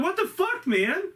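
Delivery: high, shocked what the fuck; outraged surprise. Retold or imitated. Costumed man tackled to the ground, shocked outrage. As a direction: yelp of disbelief after being tackled.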